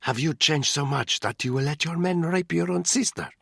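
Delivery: a sister pleading, high. A narrator's reading. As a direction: beg him to remember who she is.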